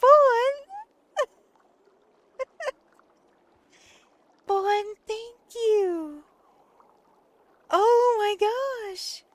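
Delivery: high, loud excited squeal; excited shout. Real, not acted. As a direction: yell it out in delight. Shouting at big donation.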